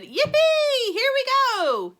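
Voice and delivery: very high, loud whoop; gleeful old man whoop; thrilled shout. A narrator's reading. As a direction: yell with delight as it drops. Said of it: "And Grandpa Joe shouted".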